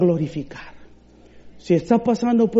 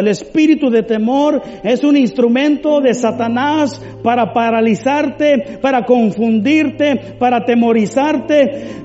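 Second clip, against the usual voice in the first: loud, high, forceful preaching; fervent deliverance prayer; 5 semitones higher, 5 dB louder than usual. Acted. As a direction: cast out fear with full authority. Oración de liberación ferviente. (oración ferviente, fuerte y aguda)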